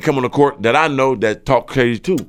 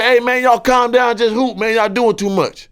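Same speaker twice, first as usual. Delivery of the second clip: higher, slow calming plea; pleading peacemaker; 10 semitones higher than usual. Retold or imitated. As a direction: talk them down, hands up. Playing peacemaker retold.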